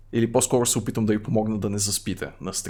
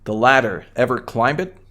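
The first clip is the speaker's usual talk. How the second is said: ordinary talk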